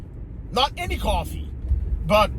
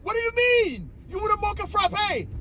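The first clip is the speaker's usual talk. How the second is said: much higher, incredulous mock rant; inner disbelief; 13 semitones higher than usual. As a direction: play the outrage he only thought. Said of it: "I didn't say all that inside".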